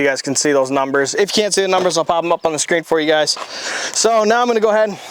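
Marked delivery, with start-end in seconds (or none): none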